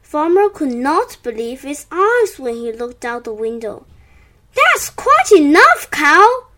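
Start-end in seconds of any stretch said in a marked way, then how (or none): 4.6-6.5 high, loud scolding call. A narrator's reading. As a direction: call out to stop the culprit. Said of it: "he called".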